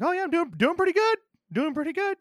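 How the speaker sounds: high, chirpy mock-naive voice; squeaky-high eager reply; naive new guy. Acts out the nice new guy.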